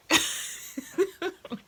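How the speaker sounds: airy high-pitched burst of laughter; breathy high laugh. Real, not acted. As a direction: let a breathy laugh escape. Laughing at her own reaction.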